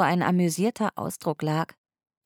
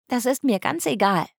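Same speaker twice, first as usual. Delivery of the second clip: higher, stubborn pout; sulky protest; 7 semitones higher than usual. A narrator's reading. Polly brushing off Huckle's offer, sulky pout. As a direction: pout and dig in your heels.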